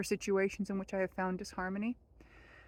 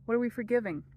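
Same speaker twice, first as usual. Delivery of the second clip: normal talking voice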